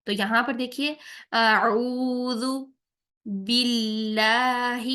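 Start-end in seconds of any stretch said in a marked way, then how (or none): 1.3-4.8 اعوذ باللہ slow, با with a thin ee sound. Shown as a demonstration. Teacher reciting اعوذ باللہ with a thin با. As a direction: keep the zer under با light, "bee". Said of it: "با کی زیر کو ہم نے باریک رکھنا ہے".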